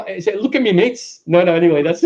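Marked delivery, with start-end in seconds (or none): 0.4-1.2 high-pitched attempt at Geordie; Geordie accent. Shown as a demonstration. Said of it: "Do that in a Geordie accent".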